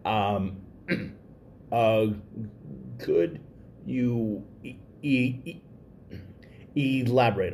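halting start, repeated blocks; stuttering. Real, not acted. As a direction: push through stuck sounds. Speaker who stutters, blocking.

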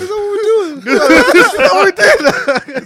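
breaking up with laughter; laughing. Real, not acted. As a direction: squeal it through laughter. Laughing hard at a joke.

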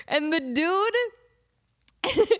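ordinary talk, nothing marked

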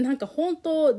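ordinary delivery

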